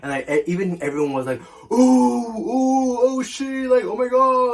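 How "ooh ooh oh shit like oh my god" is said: high, loud ooh-ing like a stunned crowd; shocked crowd gasp. Retold or imitated. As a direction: gasp it out like everyone just saw the hit. Crowd reacting to the punch.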